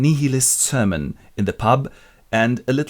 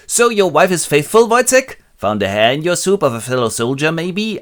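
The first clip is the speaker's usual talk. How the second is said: higher, louder teasing voice; mocking taunt; 7 semitones higher, 7 dB louder than usual. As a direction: needle him. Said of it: "pick on him".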